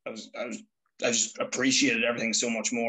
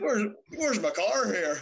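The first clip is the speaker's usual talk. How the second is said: much higher, slow mock whine; mock demand; 10 semitones higher, slower than usual. Son demanding a car for his birthday, high slow mock whine. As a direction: whine it slowly like a spoiled kid.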